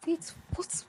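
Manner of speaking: sudden high breathy gasp of a question; startled wake-up. Retold or imitated. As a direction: jolt awake, confused and alarmed. Startled awake by a caller.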